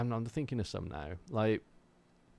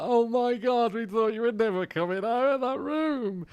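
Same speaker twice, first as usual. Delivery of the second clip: high, loud gushing dog voice; hammed-up frantic relief of a dog greeting its owner; overjoyed dogs; 13 semitones higher, 6 dB louder than usual. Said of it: "my dogs will be like".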